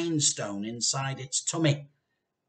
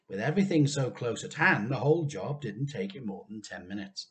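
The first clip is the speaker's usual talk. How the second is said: ordinary delivery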